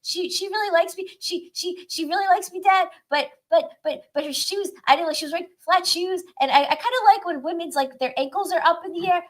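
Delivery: put-on halting, high-pitched little boy telling dad; put-on high nine-year-old voice; high-voiced kid. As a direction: stumble over words like an excited child. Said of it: "he'd have a high voice".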